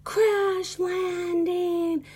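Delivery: high, drawn-out mock yell; stretched-out playful kid yell; imitating kids yelling. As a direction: ham up the kids shouting on landing. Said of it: "they, like, yell".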